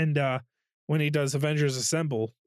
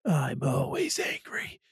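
breathy hushed Hulk delivery, fading to a whisper; Hulk line. Retold or imitated. Quoting Hulk to Cap, hushed whispered confession. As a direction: let the secret slip out in a whisper. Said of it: "And he goes".

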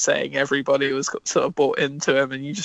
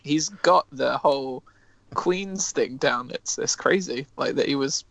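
ordinary talk, nothing marked

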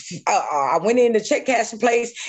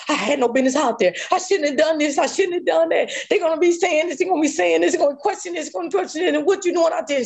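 fast, high worried rush of thoughts; panicked self-blame; 8 semitones higher, faster than usual. Retold or imitated. Woman blaming herself after the attack, fast panicked rush. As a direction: spill the fears out in a hurry.

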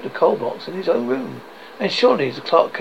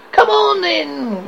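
high, loud opening retort; cheerful nephew; 6 dB louder, slower than usual. Acted. Nephew answers his grumpy uncle, gaily teasing. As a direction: call it out gaily, falling from high.

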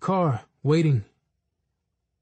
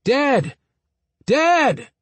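loud, high calling; 9 semitones higher, 4 dB louder than usual. Retold or imitated. Boy calling after his father, loud and high. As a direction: call after a leaving father. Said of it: "I called".

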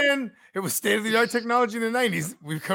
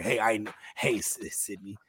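low, softer caller voice; lightly voiced killer, pitched down; killer's phone voice; 11 semitones lower, 8 dB quieter than usual. Acting the killer's phone opening.

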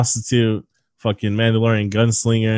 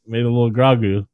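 usual speaking voice

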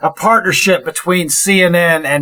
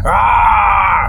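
fire dragon voice; 4 semitones higher than usual. Pretend. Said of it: "fire dragon voice here".

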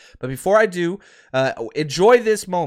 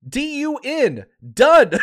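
a higher, loud final DONE; emphatic shout; 7 semitones higher, 5 dB louder, slower than usual. Real, not acted. Fan telling Packers fans their season is over, loud emphatic shout. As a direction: slam the word down like a verdict. Spelling it out to rivals.

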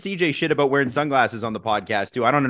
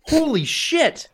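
stunned; 9 semitones higher, much slower than usual. Real, not acted. Reacting to the hot wing.

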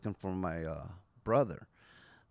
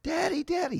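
much higher, imitating a toddler calling Daddy; little daughter calling; 5 dB louder than usual. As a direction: mimic a small girl crying out for her dad. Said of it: "She started".

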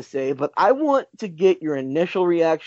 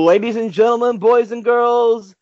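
ringmaster; 5 semitones higher, 4 dB louder than usual. Acted. Podcast host opening like ringmaster.

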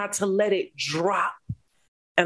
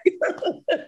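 voiceless breathy laugh, high; 12 semitones higher than usual. Real, not acted. After telling the host to take it back, a breathy laugh. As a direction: huff out a laugh.

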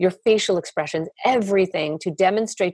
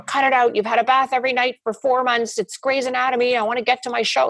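higher-pitched mock exasperation; hammed-up irritated complaint; impatient parent; 5 semitones higher than usual. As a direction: play a parent fed up with a fussing baby. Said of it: "Nobody says to the baby".

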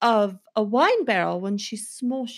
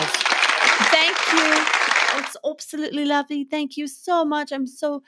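higher, put-on British accent, gushing; 5 semitones higher than usual. [name] thanks the booing audience, in a posh accent. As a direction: thank the crowd like a posh Englishwoman. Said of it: "British character".

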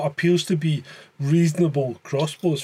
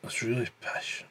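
quiet disgust; 4 semitones lower, 9 dB quieter than usual. Real, not acted.

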